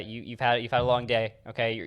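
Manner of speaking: ordinary talk, nothing marked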